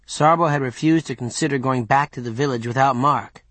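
plain reading voice

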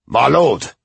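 loud alarmed call; urgent call. A narrator's reading. Bodyguard calling into the tent.

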